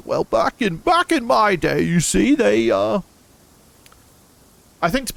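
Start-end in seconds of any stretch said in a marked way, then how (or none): 0.1-0.8 put-on old man voice. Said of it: "your old man voice". 0.8-1.8 put-on slower, higher old man take; drawn-out very old man. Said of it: "your old man voice". 1.8-3.0 a lightly voiced old man, higher and slower; wobbly old man reminiscing. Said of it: "your old man voice".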